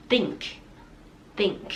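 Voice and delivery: teacher modelling think; th sound demo. Shown as a demonstration. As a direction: think with the tongue touching the upper teeth. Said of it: "แล้วเปล่งเสียง".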